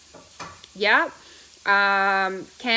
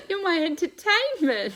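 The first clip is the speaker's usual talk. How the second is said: words squeezed out through real laughter; giggling at him between words; laughing; 12 semitones higher, 5 dB louder than usual. Real, not acted. Teasing Phil while laughing.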